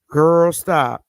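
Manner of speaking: a different monkey waving it away; mock girlfriend shutting it down; second monkey, dismissive. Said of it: "they could be talking like this".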